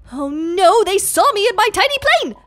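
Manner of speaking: high, rushed mock alarm; mock panic. Player caught by airport security, in mock panic. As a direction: panic playfully, pitch climbing fast.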